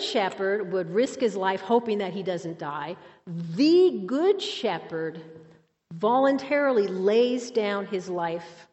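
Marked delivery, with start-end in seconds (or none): 3.5-5.2 "the" drawn out as a big THEE; slowly, with a punched, lengthened "the"; stress on "the". Shown as a demonstration. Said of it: "I want to emphasize the word "the".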